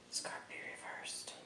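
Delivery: barely audible whispered aside. Real, not acted. The reader checking a card, whispering to herself. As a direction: whisper it to yourself. Muttering to herself over cards.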